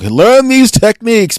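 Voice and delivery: loud, high, drawn-out order; big mock-drill shout, slow and high; imitated trainer's command. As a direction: slam it down like a pushy sales trainer. Mimics trainer slamming down book.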